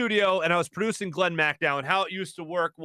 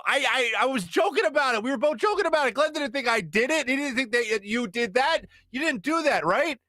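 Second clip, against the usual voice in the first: nervous pleading; 8 semitones higher than usual. Retold or imitated. Defending himself against angry cohost.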